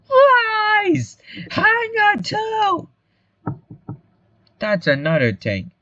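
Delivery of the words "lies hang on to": very high, drawn-out crowd scream; outraged yell. Acted. Townsfolk demanding the hanging go on, outraged yell. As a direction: screech it out in protest.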